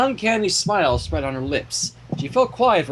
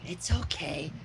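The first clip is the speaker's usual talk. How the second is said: soft Fluttershy; 11 dB quieter, slower than usual. A narrator's reading. Fluttershy comforts Dash.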